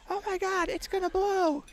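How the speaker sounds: high frantic mock woman's shriek; panicked lady. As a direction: squeal it like the scared lady outside. Panicked corner worker lady.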